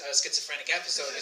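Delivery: ordinary talk, nothing marked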